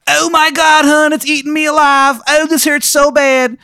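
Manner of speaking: high, loud mock screams of pain; hammed-up victim wailing; Steve screaming. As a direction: monster eating Steve alive, hammed-up screaming. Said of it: "you just hear".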